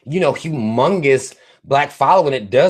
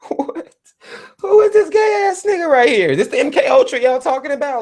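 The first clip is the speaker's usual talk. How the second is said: mock disbelief; 14 semitones higher than usual. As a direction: squeal it like you can't believe what you're seeing. Mock shocked reaction to [name].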